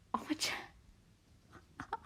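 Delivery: whispered through giggles; breathless laughing whisper. Real, not acted. Vlogger cracking up at her own slip, whispering. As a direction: laugh it out under your breath.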